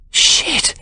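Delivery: very high, breathless gasped curse; involuntary exclamation. A narrator's reading. Sarah seeing the diamond ring, shocked exclamation. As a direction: blurt it out before you can stop yourself. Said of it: "Sarah exclaims involuntarily".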